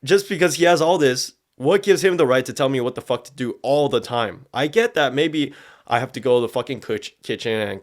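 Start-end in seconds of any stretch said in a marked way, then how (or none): none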